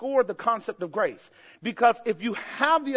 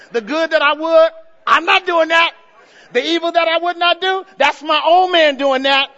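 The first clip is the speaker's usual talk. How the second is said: loud, higher full-force preaching; preaching shout; 5 semitones higher, 8 dB louder than usual. Real, not acted. Preacher crying Romans seven to the congregation, loud and high. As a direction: hammer Paul's words home to the church.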